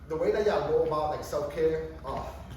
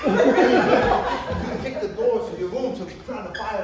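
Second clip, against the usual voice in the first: slow, louder, laughing words; can't stop laughing while telling it; laughing through it; 3 semitones higher, 7 dB louder, slower than usual. Real, not acted. Telling his daughter about kicking her door, laughing.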